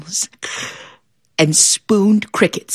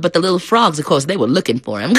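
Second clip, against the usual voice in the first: plain reading voice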